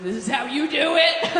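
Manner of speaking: escalated, hammed-up accusation; mock accusing shout. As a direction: shout it like you've caught them out. Same challenge escalated to a shout.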